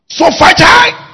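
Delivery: high, slow, loud preacher's cry; shouted declaration. Real, not acted. Preacher declaiming scripture about fighting, shouted declaration.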